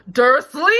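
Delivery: very loud, rising shout of the name; boomed. A narrator's reading. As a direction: boom the name across the hut. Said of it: "he boomed".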